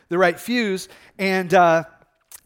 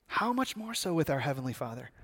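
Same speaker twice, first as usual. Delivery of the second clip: low, much softer rhetorical question; hushed reverent repeat; 4 semitones lower, 12 dB quieter than usual. Real, not acted. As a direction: let it land quietly on the listeners.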